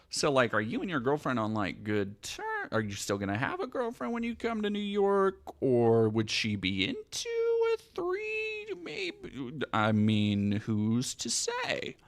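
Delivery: mock coy fishing, trailing off softly; imitating her angling. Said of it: "clue that she is trying to angle for".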